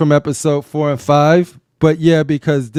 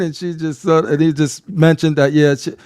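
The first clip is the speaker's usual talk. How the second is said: ordinary delivery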